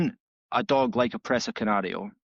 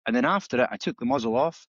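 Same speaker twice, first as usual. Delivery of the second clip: usual speaking voice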